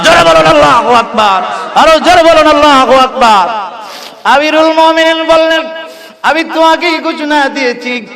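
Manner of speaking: high, ringing chanted exhortation; fervent sermon cry; impassioned preaching. Real, not acted. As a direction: rouse the congregation on a high sustained pitch.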